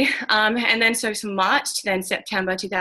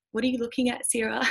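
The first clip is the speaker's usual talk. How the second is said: colleague asking; 5 semitones higher, 5 dB quieter than usual. Retold or imitated. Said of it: "I won't try and mock her accent".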